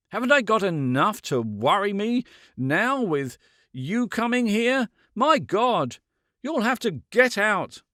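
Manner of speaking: high, loud, anguished pleading to leave; desperate anger. A narrator's reading. Man begging the woman to leave, high and loud. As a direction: push her out in desperation.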